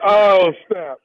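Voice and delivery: loud triumphant whoop; loud gleeful exclaim. Real, not acted. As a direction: crow it out loud. Prank revealed to victim.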